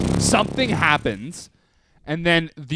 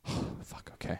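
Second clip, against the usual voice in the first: very soft, voiceless sigh of words; retold reluctant acceptance; muttered resignation; 18 dB quieter than usual. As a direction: breathe it out under the breath. Retelling muttered dread at the task.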